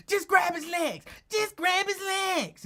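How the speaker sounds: high, loud panicked order to grab the legs; mock frantic order. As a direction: shout it up high, desperate for backup. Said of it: "Lincoln like".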